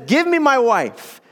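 impatient demand. Retold or imitated. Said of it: "And so he says".

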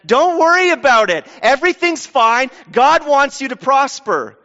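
high, loud mock reassurance; false prophet. False prophet standing up to promise prosperity, mock reassurance. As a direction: proclaim it high like a prosperity preacher. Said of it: "if a prophet stands up and says".

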